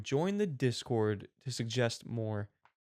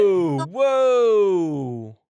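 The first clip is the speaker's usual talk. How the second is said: startled; 4 semitones higher, 11 dB louder than usual. Real, not acted. Reacting to the streamer clip.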